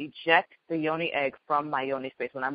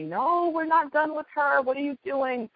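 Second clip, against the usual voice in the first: voicing her yoni; 10 semitones higher than usual. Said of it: "my yoni is telling me".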